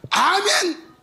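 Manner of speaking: high, loud amen; preacher demonstrating his amen; shouted amen. Shown as a demonstration. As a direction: throw the amen out with arms up. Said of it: "내처럼 이렇게".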